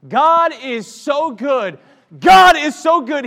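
high, loud, slow praising cry; loud praise shout. Real, not acted. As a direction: shout God's goodness out. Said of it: "why don't you just start praising God".

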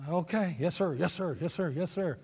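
soft, rapid obedient yes-sirs; dutiful yes sir. Retold or imitated. Driver answering his lieutenant, soft and dutiful. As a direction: mutter compliance over and over.

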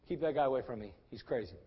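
low, soft undertone; quiet, dropping away at the end; wary aside. Acted. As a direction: mutter privately to an aide. President's aside about the caller.

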